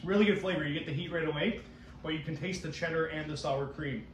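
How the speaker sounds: talking between bites; chewing while talking. Real, not acted. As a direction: mouth partly full of chips. Seen 2: hand brings chips to mouth, jaw working through the words.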